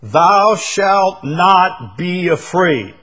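slow, loud, high commanding decree. Shown as a demonstration. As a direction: proclaim it as an order from God. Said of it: "You might even say it's a command".

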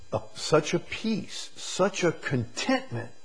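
high, loud building preaching; preaching at full force. Real, not acted. Preacher declaring dependence on the shepherd, high and loud. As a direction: build the fire up to the end.

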